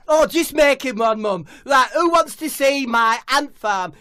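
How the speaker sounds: high, loud impatient call; high loud shout at mum. Acted. Harry calling to mum.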